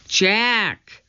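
high, drawn-out call with falling end; calling from above. A narrator's reading. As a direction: call his name down to him. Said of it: "Annie was calling from above".

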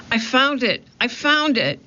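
high, thrilled shout of discovery; a boy yelling he found treasure; excited cry. A narrator's reading. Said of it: "cried Jack".

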